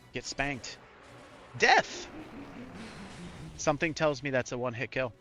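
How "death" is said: dramatic shout. Acted. Reading enemy spell name.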